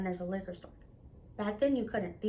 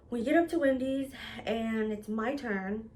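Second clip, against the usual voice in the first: usual speaking voice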